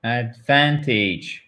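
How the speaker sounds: "Advantage" said very slowly, stress on "van", falling tune; slow careful repeat. Shown as a demonstration. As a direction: drag "Advantage" out, hit "van" and let it fall. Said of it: "The stress is on the second syllable".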